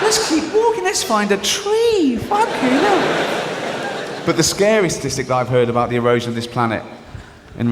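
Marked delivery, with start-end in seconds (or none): 0.0-4.2 very high, squeaky complaining voice; squeaky freezing settler. Acted. Settler at the back moaning to the leader, very high and squeaky. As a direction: whine shrilly like a frozen little man.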